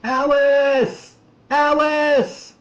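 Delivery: high, loud screamed name; repeated high cry for help; desperate scream. A narrator's reading. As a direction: scream out to her from far down the road. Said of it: "began to hear his voice".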